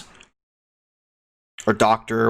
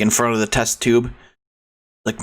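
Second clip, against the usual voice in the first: normal talking voice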